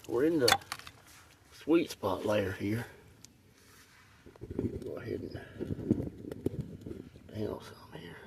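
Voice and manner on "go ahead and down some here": soft whisper to self; hushed, then a startled breath. Real, not acted. Quiet digging then a find.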